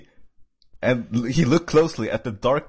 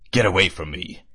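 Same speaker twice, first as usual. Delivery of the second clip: lightly growled, lowered voice; a hint of a warning growl; low growl; 4 semitones lower than usual. A narrator's reading. Wounded hedgehog warns Monica off, low mild growl. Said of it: "he growled".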